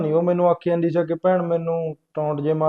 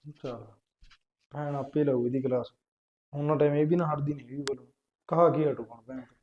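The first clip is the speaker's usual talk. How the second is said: quiet aside; 4 semitones lower, 11 dB quieter than usual. Acted. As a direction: mutter it to the audience under your breath. Brother's aside after the quarrel.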